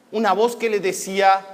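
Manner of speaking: plain reading voice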